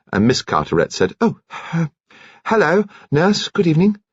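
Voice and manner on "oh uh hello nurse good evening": put-on higher, shy caught-out girl; flustered young woman. A narrator's reading. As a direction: stammer it shyly. Narrator voices Rose, blushing.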